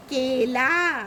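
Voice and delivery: केला drawn out as kay, laa; long e. Shown as a demonstration. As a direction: hold both vowels of केला. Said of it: "इसको कैसे pronounce करते हैं?".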